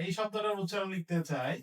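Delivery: ordinary delivery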